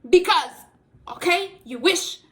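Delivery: loud, high sassy retort; sassy comeback. Retold or imitated. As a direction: snap back playfully. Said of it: "And I'm like".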